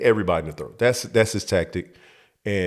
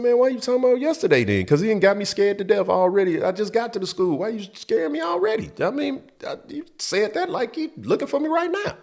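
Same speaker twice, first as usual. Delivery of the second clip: put-on very high panicky kid voice; scared kid; 13 semitones higher than usual. Boy scared by Cedric's warning, very high panicky whine.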